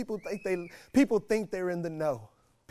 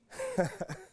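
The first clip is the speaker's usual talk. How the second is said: laugh. Real, not acted. Laughs after preaching.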